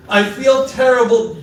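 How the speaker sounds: a fake-sorry spouse setting up an excuse; mock half-apology. Shown as a demonstration. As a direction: drag out a grudging apology. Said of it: "Don't ruin a good apology with a".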